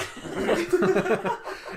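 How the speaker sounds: a high, breathy burst of laughter at his own story. Real, not acted. Laughing at his tattoo story, a high laugh.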